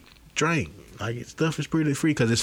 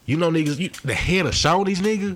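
incredulous; 7 semitones higher than usual. Real, not acted. Incredulous high exclamation.

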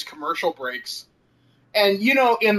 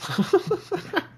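high giggle; 12 semitones higher than usual. Real, not acted. Cracking up at the Alps edit, high giggle. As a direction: burst of high-pitched laughter.

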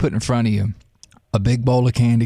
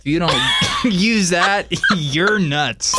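laughing; 5 semitones higher, slower than usual. Real, not acted. Laughing at rejected joke.

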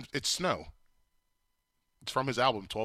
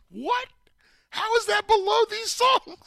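high, loud outraged what; shocked disbelief; 7 dB louder than usual. Real, not acted. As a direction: cry out in disbelief. Outraged at chart ranking.

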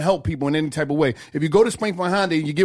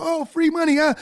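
high mock voice; 12 semitones higher than usual.

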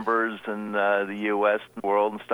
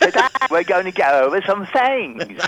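mock Cockney; 6 semitones higher, 5 dB louder than usual. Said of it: "I do not speak the Cockney a-, dialect".

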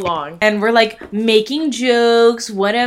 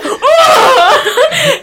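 laughing yelp of pain; 7 dB louder than usual. Retold or imitated. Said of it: "So he's like".

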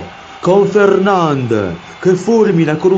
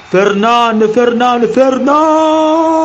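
goal-call shout; 10 semitones higher, 5 dB louder, much slower than usual. Acted. Commentator builds to a shot.

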